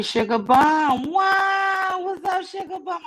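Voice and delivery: high, drawn-out delighted "wow"; excited shout-out. Real, not acted. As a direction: gush a loud, high greeting to a fan. Greeting a fan in the chat.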